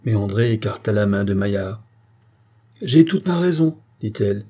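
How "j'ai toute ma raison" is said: high, louder firm denial; indignant protest. Acted. Countess protests to the tribunal she is sane, raised voice.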